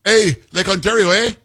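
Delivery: high, hammed-up Canadian "Hey, Lake Ontario, eh?"; playful send-up of a Canadian premier; Canadian accent mock. Host repeating his Ford bit for the studio, exaggerated Canadian accent. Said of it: "that's a spot-on impersonation of this guy Ford".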